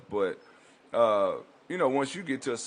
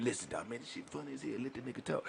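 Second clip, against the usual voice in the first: hushed, much softer aside; imitated whispers; 3 semitones higher, 11 dB quieter, faster than usual. Inmates urging each other to listen to the story, whispered. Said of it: "you hear them whispers".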